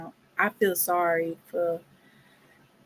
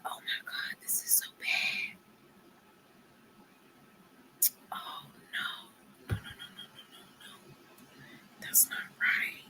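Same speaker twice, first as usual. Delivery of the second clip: hushed, horrified whisper; 16 dB quieter than usual. Retold or imitated. Looking at the man's teeth, a horrified whisper. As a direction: whisper it in disbelief at the photo. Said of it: "and I was like".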